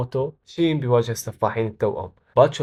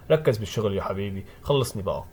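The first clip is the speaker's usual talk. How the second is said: low, softer dismissive reply; unimpressed; 4 semitones lower, 3 dB quieter than usual. A narrator's reading. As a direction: brush him off, lower and quieter. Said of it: "ساكاموتو كالعادة ما بيتأثر".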